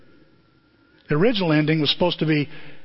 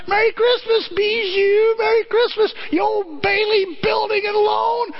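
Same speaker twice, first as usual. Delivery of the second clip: ecstatic shout. Retold or imitated. George greets the Bailey building, high joyful shout. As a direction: fling the greeting out.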